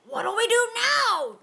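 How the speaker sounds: frantic pirate yell; panicked yell. A narrator's reading. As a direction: cry it out in panic. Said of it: "yelled one of the pirates".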